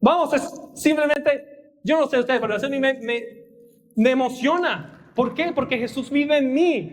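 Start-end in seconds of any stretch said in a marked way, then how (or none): none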